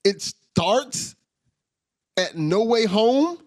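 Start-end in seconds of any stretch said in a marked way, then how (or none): none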